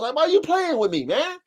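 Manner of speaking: high, quick disbelief; why are you wasting my time, man; exasperated. Real, not acted. Exasperated at cheap buyers.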